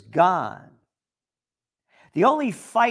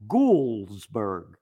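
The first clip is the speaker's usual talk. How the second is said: ordinary delivery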